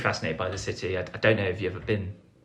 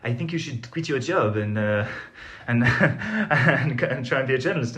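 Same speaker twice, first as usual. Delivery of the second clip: a light, friendly imitation of a French accent; nice French accent; 4 semitones higher than usual. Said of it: "in a very nice kind of, uh, French accent".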